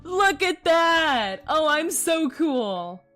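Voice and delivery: usual speaking voice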